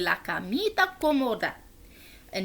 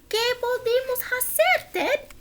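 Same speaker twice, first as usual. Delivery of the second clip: high character voice; 13 semitones higher than usual. Acted. Fred voiced in Spanish.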